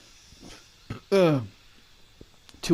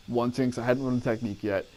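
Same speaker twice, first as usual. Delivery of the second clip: ordinary talk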